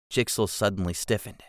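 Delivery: ordinary delivery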